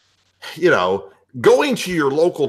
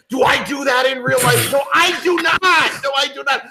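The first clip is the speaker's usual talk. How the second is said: heated defence; 8 semitones higher than usual. Real, not acted. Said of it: "that's what the cow says".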